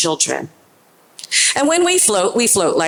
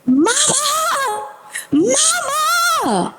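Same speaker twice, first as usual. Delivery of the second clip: very high child's cry of Mama, imitated; imitating child screaming; much slower than usual. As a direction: voice a frightened toddler calling for mom. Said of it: "and he is screaming".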